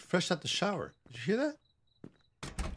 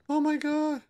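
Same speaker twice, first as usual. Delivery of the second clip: much higher shocked gasp; 12 semitones higher, slower than usual. Real, not acted. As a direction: blurt it out startled. Shocked by something in game.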